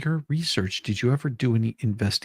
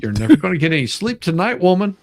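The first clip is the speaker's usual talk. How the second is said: quick, louder mock grumble; imitating Yamaha; 4 semitones higher, 6 dB louder, faster than usual. Teasing the witness about her partner, mock grumble. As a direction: play an impatient partner complaining, lightly. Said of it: "is going".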